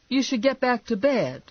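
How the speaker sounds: high-pitched wifely order; exasperated wife. Acted. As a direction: send him off in a high voice. Wife sends husband to bed.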